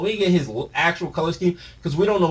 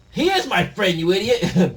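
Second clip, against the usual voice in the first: higher, louder furious reply; angry retort; 7 semitones higher, 6 dB louder than usual. Acted. Gerd defending his friend, angry shout. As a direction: snap back in defence.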